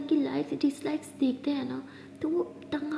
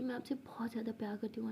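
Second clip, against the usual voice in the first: soft whisper; 10 dB quieter than usual. Acted. First whispered love confession.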